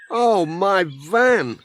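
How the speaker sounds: slow, high, dismayed cry. A narrator's reading. Pat sees his spotted van, slow dismayed cry. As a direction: drag it out in shock.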